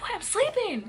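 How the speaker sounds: high, loud cranky whine; acting out being rudely woken. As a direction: snap back like a cranky sleeper. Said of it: "I was cranky as hell".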